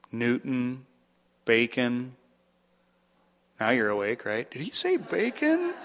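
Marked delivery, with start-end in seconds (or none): 4.5-5.7 mock listener voice. Mock listener hearing "Bacon.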